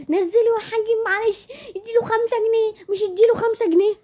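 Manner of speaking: high mimicking whine of the wife's words; high mock whine; mocking imitation. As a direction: parrot her back in a high whine.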